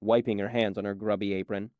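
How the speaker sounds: ordinary delivery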